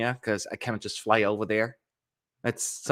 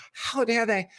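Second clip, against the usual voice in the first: mock outrage; 11 semitones higher than usual. Repeats mock outrage, higher.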